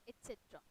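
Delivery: "et cetera" said et-SET-ra, no k; correct pronunciation. Shown as a demonstration. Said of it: "it is, uh, spoken as". ("et cetera" का सही उच्चारण, एट-सेट्रा)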